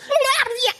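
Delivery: high garbled babbling burst; short frantic babble; Donnie impression. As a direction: blurt out wild wordless gibberish. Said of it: "Shout out Donnie".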